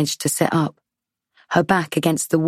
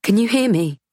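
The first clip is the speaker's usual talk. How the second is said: raised anxious call; 6 semitones higher than usual. Acted. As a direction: call out to wake her. Calling to injured woman.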